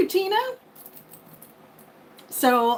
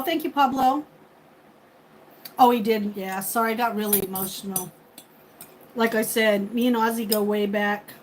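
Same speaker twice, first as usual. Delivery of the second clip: ordinary delivery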